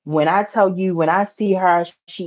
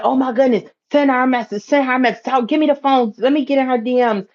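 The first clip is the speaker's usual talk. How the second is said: urgent excitement; 7 semitones higher, faster than usual. Retold or imitated. Urging about messaging the friend, high urgent rush. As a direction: hurry to grab the phone. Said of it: "me said".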